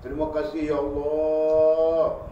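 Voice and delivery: high, drawn-out grateful wail; tearful thanks. Retold or imitated. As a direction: cry out thanks to God as the grateful poor man. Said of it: "menangis dia".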